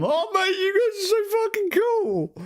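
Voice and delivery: very high, squealing mock adoration; gushing fanboy. Player gushing at keen kids, squealing very high. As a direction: squeal it like an overexcited fan. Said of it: "Any of the kids that show even a smidge".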